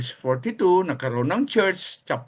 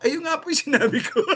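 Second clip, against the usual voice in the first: laughing reply; 9 semitones higher, much faster than usual. Retold or imitated. Retelling his reply while laughing.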